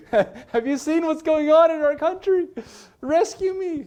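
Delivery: high, loud panicky wail; hammed-up begging for rescue; mock pleading. Preacher imitates believers begging the Lord, mock desperate. Mock plea continues through a laugh.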